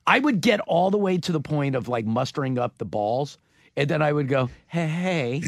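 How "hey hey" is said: timid put-on greeting, voice altered; meek. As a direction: try to flirt and come out meek. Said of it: "You can't say it in that voice".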